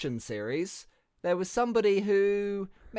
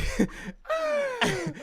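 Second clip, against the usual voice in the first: squealing high laugh; high shrieking laugh. Real, not acted. Laughs at a rival's loss.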